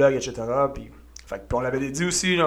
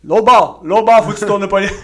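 shouted order, higher and louder; loud coaching shout; 7 semitones higher, 9 dB louder than usual. Pretend. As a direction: bark the correction like a gym coach. Coach qui crie une consigne. (cri d'entraîneur)

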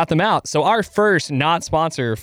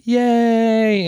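high, drawn-out imitated cheer; long, high-pitched mock "yay"; mock crowd cheer; 10 semitones higher than usual. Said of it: "And everyone said".

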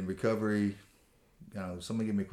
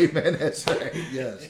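laughing amen; 7 semitones higher, 7 dB louder, slower than usual. Real, not acted.

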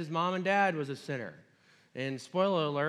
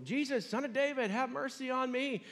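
yelling; 10 semitones higher than usual. Retold or imitated. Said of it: "he just yells even louder".

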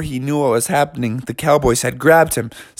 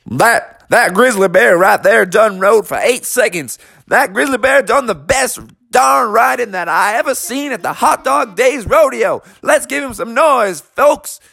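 higher, twangy announcer call; rodeo announcer; 8 semitones higher than usual. Acted. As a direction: pump up the crowd over the mic.

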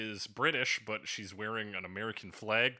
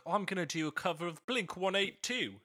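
higher-pitched mock British accent; imitated British singer; 8 semitones higher than usual. Singer announcing a cover to her dad, mock British accent. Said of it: "came in to her, her dad with this".

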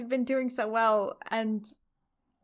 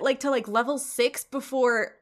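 usual speaking voice

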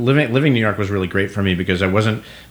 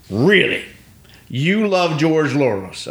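skeptical disbelief; 8 semitones higher, slower than usual. Retold or imitated. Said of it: "so she's already mad".

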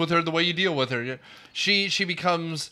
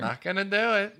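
George H. W. Bush impression; 3 semitones higher than usual. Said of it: "get Dana Carvey".

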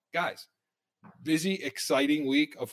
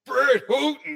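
mimicking cohost; 10 semitones higher, 6 dB louder, slower than usual. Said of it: "he says".

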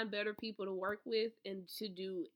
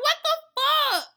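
shocked outrage; 14 semitones higher, 10 dB louder than usual. Retold or imitated. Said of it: "I was pissed".

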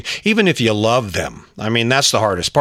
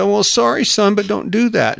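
consoling father; 9 semitones higher than usual. Acted. Father hugging his hurt son, consoling. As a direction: console your son in a raised, coddling pitch. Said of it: "you hug him and say".

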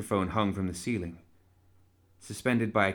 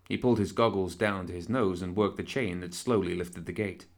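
plain reading voice

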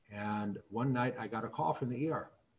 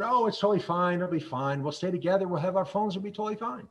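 put-on higher, louder teenage reassurance; imitating a teen waving away concern; dismissive teen; 7 semitones higher, 6 dB louder, faster than usual. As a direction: brush off mom's worries in a rush. Daughter brushes off mom's worry.

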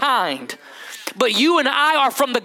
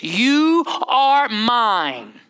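solemn divine voice; much slower than usual. Acted. God's voice in sermon, slow.

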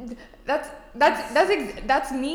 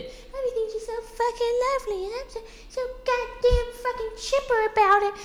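much higher, sing-song mock sweetness; imitating a goody two-shoes influencer, high and sugary; mock chipper voice; 14 semitones higher than usual. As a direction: sneer at her by playing fake-nice. Mocking a wholesome influencer persona.

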